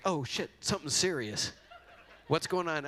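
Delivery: playfully alarmed exclamation; mock alarm. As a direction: react as if it's suddenly serious. Acting his alarmed reaction to eyebrows.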